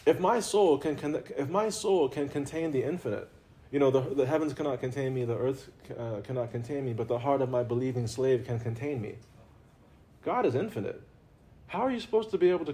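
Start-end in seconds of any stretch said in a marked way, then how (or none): none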